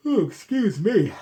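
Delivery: yawning through the words; real yawn, stretched and high. Real, not acted. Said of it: "When you yawn".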